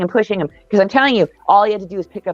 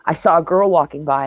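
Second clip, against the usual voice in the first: ordinary delivery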